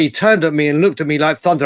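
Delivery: ordinary talk, nothing marked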